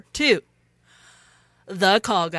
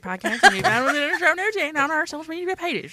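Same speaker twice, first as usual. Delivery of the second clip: rapid, slurred mumbling run-on voice; Boomhauer; faster than usual. Acted. Host reading the network plug as fast-mumbling Boomhauer. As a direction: rattle it off as an almost unintelligible mumble. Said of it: "[name] does Boomenhauer".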